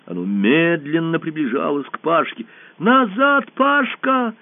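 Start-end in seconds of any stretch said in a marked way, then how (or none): 2.8-4.3 high, loud urgent cry; girl warning her friend of danger; warning shout. A narrator's reading. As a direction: yell at him to get back. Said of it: "крикнула Алиса".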